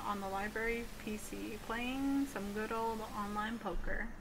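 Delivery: ordinary delivery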